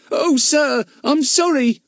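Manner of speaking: put-on high, anxious boy apology; a fretful errand boy; apologetic boy. A narrator's reading. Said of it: "cried the boy".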